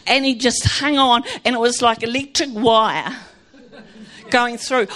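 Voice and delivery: normal talking voice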